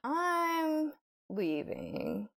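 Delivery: high, very slow "I'm leaving", drawn out in disbelief; mock-prim exit line, stretched slowly up high; disgusted walk-out. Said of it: "And I was just like".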